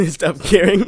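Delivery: loud, slow, high laugh through the words. Real, not acted. Co-host finishing the host's sentence, laughing out loud. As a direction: crack up while finishing the phrase.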